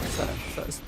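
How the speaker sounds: hushed, voiceless whisper. Real, not acted. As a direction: whisper so the guard won't hear. Sneaking up on NPC, whispering.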